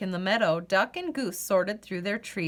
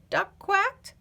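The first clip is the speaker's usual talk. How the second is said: usual speaking voice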